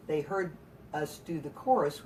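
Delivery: usual speaking voice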